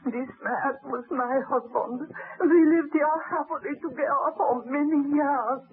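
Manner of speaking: normal talking voice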